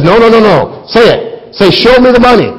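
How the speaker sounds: insistent demand. Retold or imitated. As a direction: push him to say it right. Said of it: "And he says".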